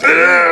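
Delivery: high hammed-up attack shout; mock battle cry. Picturing Crystal attacking, high mock yell. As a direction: charge in with a silly war cry. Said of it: "Like, just".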